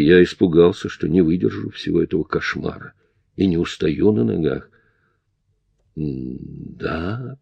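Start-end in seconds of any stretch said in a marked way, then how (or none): 6.0-7.4 тихий шёпот, почти беззвучно; выдохнуть шёпотом; whispered. A narrator's reading. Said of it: "прошептал я". (шёпотом)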